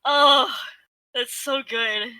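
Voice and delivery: ordinary delivery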